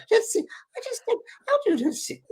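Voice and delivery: very high Scottish impression; Scottish accent. Storyteller demonstrating the Scottish lady, high attempted Scottish accent. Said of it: "I'm trying to my Scottish accent".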